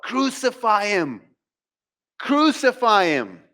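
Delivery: high-pitched crowd cry, falling at the end; mock crowd yell. Preacher voices the crowd turning on Jesus, yelling. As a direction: call it out like an angry mob, not full force. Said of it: "will yell out".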